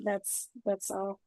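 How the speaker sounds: ordinary delivery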